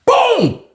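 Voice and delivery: very high, sudden "boom"; imitated punch boom. Storyteller acting out his swing landing, high-pitched boom. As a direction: pop out the impact sound way up high.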